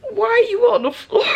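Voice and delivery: high, quick mock-puzzled kid; son asking. Son asking why mom is on the floor, high and quick. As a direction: voice the son's baffled question. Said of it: "He like".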